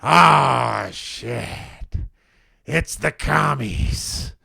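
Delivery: put-on slow, raspy-sounding confused old woman, loud on the first word; old lady. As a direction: imitate a lost old lady spotting "commies", dragging the words. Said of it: "she goes".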